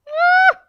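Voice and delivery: sharp sudden high yelp; a real startled cry, loud and high; one quick shocked shriek; startled shout. Real, not acted. Startled cry while cutting.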